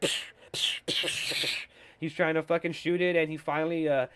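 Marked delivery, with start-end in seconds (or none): 0.0-1.5 soft psh shots; imitated gunshots. Imitating Joel's sniper shots, softly. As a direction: puff out silenced sniper shots. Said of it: "He's like".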